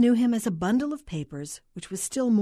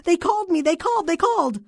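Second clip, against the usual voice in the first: high, loud, frantic cries; distraught caller at breaking point; hysterical outburst; 11 semitones higher, 7 dB louder than usual. A narrator's reading. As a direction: wail it insistently, repeating yourself in panic. Hysterical caller pressed for answers.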